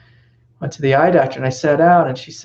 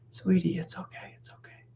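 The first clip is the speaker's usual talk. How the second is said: soft, hushed comforting murmur; hushing a baby held close; soothing baby; 4 semitones higher, 10 dB quieter than usual. Retold or imitated. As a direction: soothe a crying baby, barely above a whisper.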